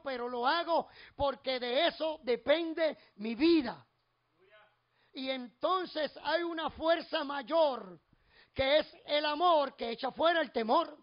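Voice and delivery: loud, slow, drawn-out sermon climax; full-force preaching. Real, not acted. Preacher declares what love for God makes him do, full-force preaching. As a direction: build to a forceful rhythmic declaration, punching each phrase.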